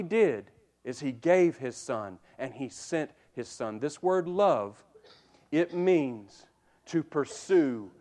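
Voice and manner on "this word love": ordinary talk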